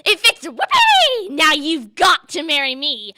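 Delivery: high, very loud triumphant scream. A narrator's reading. Ugly sister claiming the prince as husband, screamed. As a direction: shriek it in triumph. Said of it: "But she screamed".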